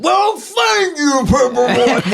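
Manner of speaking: high, slow yelled line; loud shout. Retold or imitated. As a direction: bellow it, laughing. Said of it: "but ten times louder than I just said it".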